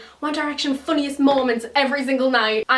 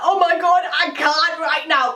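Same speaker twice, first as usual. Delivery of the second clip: mock overwhelmed excitement; overwhelmed fangirl; 5 semitones higher, faster than usual. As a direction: act like you can barely cope. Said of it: "and I was like".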